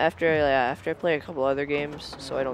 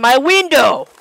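loud shocked cry, much higher; shocked yell; 11 semitones higher, 16 dB louder than usual. Real, not acted. Gamer yelling when the window breaks, shocked shout. Game character's window smashed.

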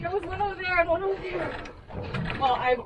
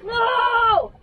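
long, high cry of outrage; dismayed shout; 5 semitones higher, much slower than usual. Real, not acted. Angler to sharks that ate the fish, dismayed shout. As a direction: yell at thieving sharks, drawn out.

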